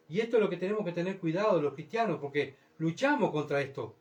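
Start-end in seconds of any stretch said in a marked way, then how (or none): none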